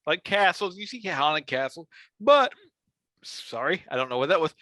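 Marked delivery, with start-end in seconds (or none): 2.2-2.5 a sudden hiccup breaks the word; hiccuping mid-sentence. Real, not acted. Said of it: "I have the hiccups".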